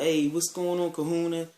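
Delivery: high, loud acted hello; hearty greeting. Friend greets him in party chat, hearty.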